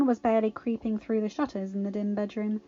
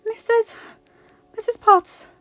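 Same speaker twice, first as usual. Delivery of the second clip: gasping; 13 semitones higher, 10 dB louder than usual. A narrator's reading. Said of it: "Belle gasped".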